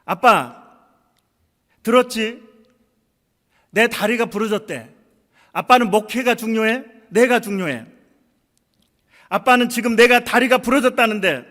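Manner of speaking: put-on high, loud, hurt demanding child; angry protest. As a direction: hurl it back at your father. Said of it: "그렇게 너는 화를 내며 나한테 따져야".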